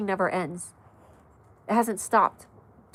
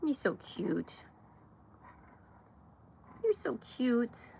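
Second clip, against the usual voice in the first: cooing to dog; 3 semitones higher, 10 dB quieter, slower than usual. Real, not acted.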